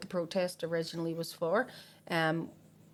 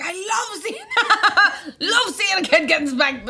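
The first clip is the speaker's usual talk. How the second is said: can barely get it out for laughing; giggling through the quote; 12 semitones higher, 11 dB louder, slower than usual. Retold or imitated. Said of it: "She was like".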